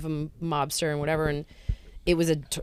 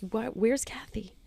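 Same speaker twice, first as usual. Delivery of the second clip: whisper; 5 semitones higher, 4 dB quieter than usual. Retold or imitated. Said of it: "He's like".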